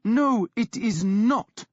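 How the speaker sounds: lightly voiced stern priest; stern authority. A narrator's reading. Priest firmly contradicting the raging woman. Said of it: "spoke with a stern authority".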